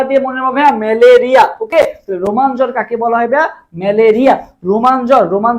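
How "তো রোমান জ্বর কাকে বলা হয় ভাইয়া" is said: ordinary delivery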